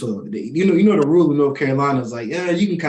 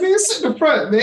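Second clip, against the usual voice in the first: higher, lightly voiced cop; cool officer voice; 13 semitones higher than usual. Officer telling the driver to sit up front, lightly imitated. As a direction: mimic the friendly officer, lifting the pitch. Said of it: "He said".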